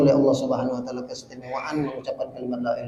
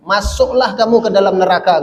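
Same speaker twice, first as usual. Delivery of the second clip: raised, commanding quote; stern command; 5 semitones higher, 5 dB louder than usual. Retold or imitated. Preacher quotes God ordering disbelievers into hell, stern command. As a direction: deliver it as a loud divine order.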